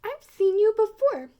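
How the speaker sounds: high, loud astonished cry; shocked recognition. A narrator's reading. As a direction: blurt it out in disbelief. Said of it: "gasped Maisie".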